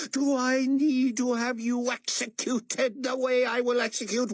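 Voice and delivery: high, loud threats, slowing on Do you hear me; seething shouted threat; menacing fury. A narrator's reading. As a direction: threaten him with death. Carpathia threatens Moon with execution.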